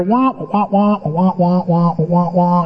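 muffled wordless wah-wah droning; steady trombone-like wah-wah babble; Charlie Brown teacher. Retold or imitated. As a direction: imitate a teacher's meaningless drone. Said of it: "they sound like the Charlie Brown teacher".

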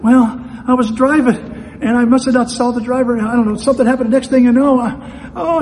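high, loud imitation of a crying crash victim; mock tearful woman. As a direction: babble the account as if upset, breaking off. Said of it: "and she's crying".

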